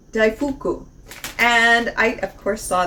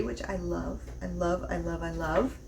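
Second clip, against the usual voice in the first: much softer, repeated I love to herself; half to herself, softer and fading; murmured gushing; 3 semitones lower, 12 dB quieter than usual. Real, not acted. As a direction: private delighted murmur. Gushing over the history booklet.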